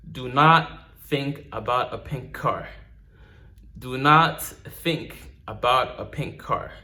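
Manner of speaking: slow, each word weighed; slow deliberate instruction. Shown as a demonstration. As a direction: say it slowly like a hypnotist. Slow repeated hypnotic instruction.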